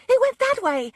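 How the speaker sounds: a high, loud yell pointing after the cat. Acted. Susan yelling as her panther runs off, high loud yell. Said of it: "Susan was yelling".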